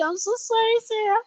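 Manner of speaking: a high, soft, squeaky sorry; squeaky apology. Real, not acted. High, soft, squeaky apology to viewer Sarah.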